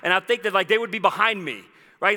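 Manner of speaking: ordinary delivery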